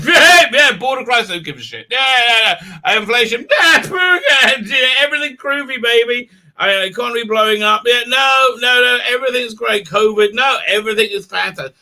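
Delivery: high, breezy hammed-up brush-off; mock dismissive media. Streamer mocking the media's dismissive attitude, high and breezy. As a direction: brush off every crisis in a high, careless sing-song. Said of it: "and the media's like".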